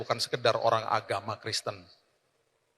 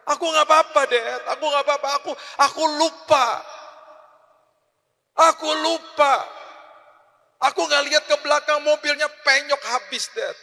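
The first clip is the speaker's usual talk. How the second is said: put-on much higher, louder sobbing boy's voice; imitated sobbing child; 13 semitones higher, 7 dB louder than usual. Son sobbing to his father after the accident, high and tearful. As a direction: cry it out like a scared little son. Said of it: "dia nangis di pundak saya".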